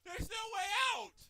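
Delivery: high, whiny, cracking shout; real whiny protest with cracks. Real, not acted. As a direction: wail it so the voice breaks. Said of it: "my voice keeps cracking".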